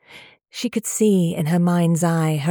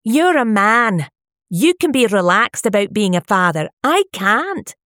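louder, higher heated complaint; heated argument; 4 semitones higher, 5 dB louder than usual. A narrator's reading. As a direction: throw it at him in frustration. Wife arguing with husband.

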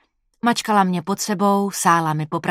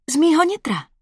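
put-on girlfriend's voice; 9 semitones higher, 4 dB louder than usual. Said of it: "upřesnila".

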